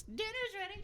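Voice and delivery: high sing-song call from afar; yelling across the room. Acted. Partner calling from a distance.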